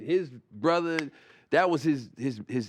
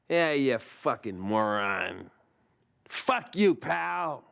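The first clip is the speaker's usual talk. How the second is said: Daryl's moron insult; much slower than usual. Retold or imitated. Comic imitates Daryl's signature insult, slow sneering. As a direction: savour the insult like pouring acid. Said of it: "the way he would say moron".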